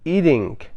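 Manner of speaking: American flapped t in "eating"; American pronunciation. Shown as a demonstration.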